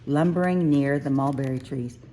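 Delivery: usual speaking voice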